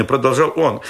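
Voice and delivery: plain reading voice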